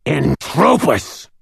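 slow, high snarled incantation; snarled spell. A narrator's reading. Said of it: "with a snarled".

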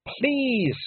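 high-pitched plea; desperate shout. A narrator's reading. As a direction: cry out for help to nobody. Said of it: "she shouted".

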